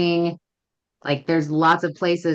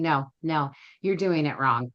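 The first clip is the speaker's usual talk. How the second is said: plain, unemotional verdict; matter-of-fact correction. Retold or imitated. Trainer correcting a student in class, matter-of-fact. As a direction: correct them evenly, without any drama. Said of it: "very matter-of-factly saying".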